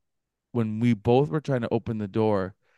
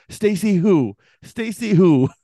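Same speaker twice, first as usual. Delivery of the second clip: high, louder incredulous challenge; arguing; 7 semitones higher, 7 dB louder than usual. Retold or imitated. Couple challenge the intruder's story, imitated heated arguing. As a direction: act out an indignant repeated demand. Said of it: "kinda arguing with him".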